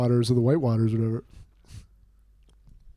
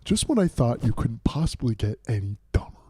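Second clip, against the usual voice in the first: movie quote; 6 semitones lower than usual. Retold or imitated. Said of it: "in Dumb and Dumber".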